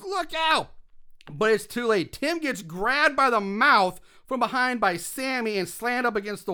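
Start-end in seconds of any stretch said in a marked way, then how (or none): 0.0-0.7 high-pitched mock shout of warning; retold warning yell. Eddie warning Tim too late, mock yelling. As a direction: imitate yelling a warning, not full volume. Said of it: "Eddie yells".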